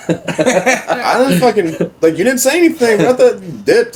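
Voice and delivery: high, loud yell through laughter; laughing back at Southside's excuse. Retold or imitated. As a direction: yell it while cracking up. Own laughing retort.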